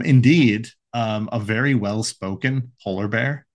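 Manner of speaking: ordinary delivery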